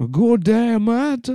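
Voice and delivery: very high mock African-Australian accent. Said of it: "Australian African".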